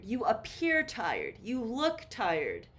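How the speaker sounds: normal talking voice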